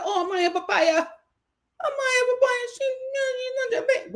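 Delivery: high-pitched mock cooing; mocking imitation of gushing fans. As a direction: mimic a stan in a high, whiny little voice. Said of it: "The one that said".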